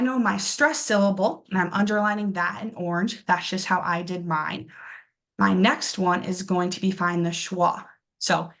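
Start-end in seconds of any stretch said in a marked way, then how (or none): none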